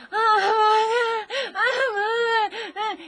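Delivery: high, loud imitated baby shrieks; frantic toddler wails. Mother imitating her screaming toddler son, shrill wails. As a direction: squeal like a frantic little monkey. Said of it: "You'd be like".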